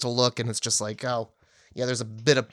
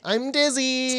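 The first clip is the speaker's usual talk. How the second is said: slow, high, pitiful moaning; milk the head injury for sympathy; whiny fake victim; 8 semitones higher, slower than usual. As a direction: Jack playing wounded and helpless, slow and pitiful. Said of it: "being like".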